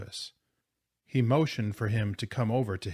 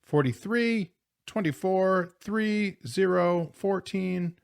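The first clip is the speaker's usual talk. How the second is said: high, chanted snap count; quarterback's count; 9 semitones higher than usual. A narrator's reading. Quarterback counting before the snap, calling out. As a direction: call the numbers out on a high, held pitch. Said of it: "The quarterback started a long count".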